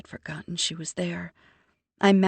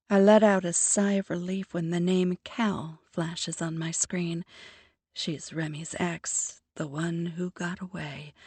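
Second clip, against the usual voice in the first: plain reading voice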